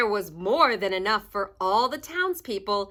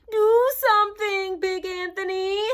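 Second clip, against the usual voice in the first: high, loud plea; shouting crowd; 8 semitones higher, 3 dB louder than usual. A narrator's reading. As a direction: beg him urgently. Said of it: "they shouted".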